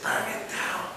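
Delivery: a hissed stage whisper; retold whisper. Pastor behind him on stage whispering his advice. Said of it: "the pastor behind me whispered".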